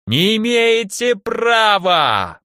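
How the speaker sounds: high, slow, drawn-out scream to the sky; desperate shout. Acted. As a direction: shout it up to the heavens. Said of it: "попытался докричаться до небес старик".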